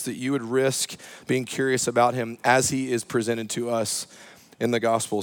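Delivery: ordinary talk, nothing marked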